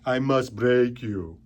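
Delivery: slow Russian boxer menace; Rocky villain voice. Retold or imitated. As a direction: drag out each word coldly. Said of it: "even frickin' Rocky's done it".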